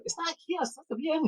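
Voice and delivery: high, very soft mock mumble; imitated guide muttering. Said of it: "And Zorro's like".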